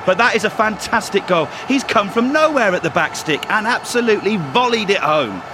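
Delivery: buzzing co-commentator; excited pundit. Pretend. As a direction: gush about the goal. Co-commentator excited after goal.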